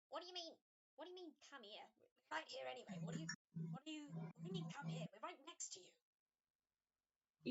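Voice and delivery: the right voice for the baffled creature; corrected character voice. Acted. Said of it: "Uh, wrong voice".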